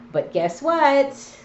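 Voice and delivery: slow, loud teasing tease. Real, not acted. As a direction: drag it out to tease. Teasing emphatic setup.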